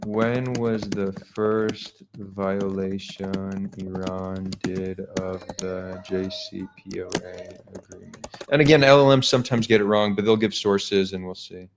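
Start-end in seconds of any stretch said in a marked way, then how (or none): 0.1-8.3 very slow, drawn-out words trailing away softly; dictating while typing. Real, not acted. Host typing a query to an LLM, slow dictation. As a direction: say it word by word as you type it.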